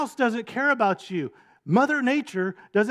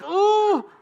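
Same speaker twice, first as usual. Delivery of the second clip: high loud horrified oh; shocked gasp; 13 semitones higher, 6 dB louder than usual. Retold or imitated. Family watching a bird die on TV, high shocked oh. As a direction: cry out in mock horror.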